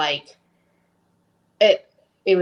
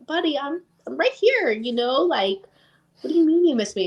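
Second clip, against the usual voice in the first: tender reassurance; 8 semitones higher than usual. Retold or imitated. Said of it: "And I'm like".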